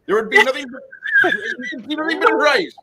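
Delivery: high hammed-up Indian accent; mock Indian accent. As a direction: joke Indian uncle, scandalised. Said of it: "really good at those Indian accents".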